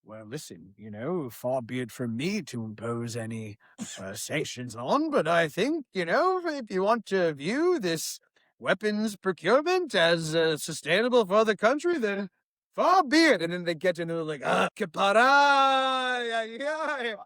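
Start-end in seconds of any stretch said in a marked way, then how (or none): 0.0-13.4 a lightly done stuffy British diplomat; posh British impression. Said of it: "outside, they're just like". 14.4-17.2 put-on a light Israeli accent with drawn-out ay; sighing Israeli ay, lightly put on. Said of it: "you went Israeli accent".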